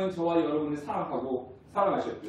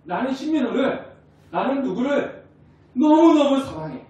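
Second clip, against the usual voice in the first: higher, swelling to a loud heartfelt cry of love; God's loving voice; 6 semitones higher, 6 dB louder than usual. Acted. God declaring his love to the congregation, a lightly acted heartfelt call. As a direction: voice God confessing his love to each person. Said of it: "이렇게 지금 말씀하고 계시는 거예요".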